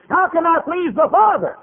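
very high, loud exclamation; fervent preacher cry. Real, not acted. Preacher rousing the congregation about Jesus, high loud cry. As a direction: cry it out to the whole church.

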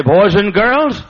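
put-on loud, slow call of boys and girls; announcing to kids. Shown as a demonstration. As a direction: call the children to attention. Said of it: "And I'll say".

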